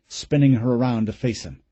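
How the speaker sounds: usual speaking voice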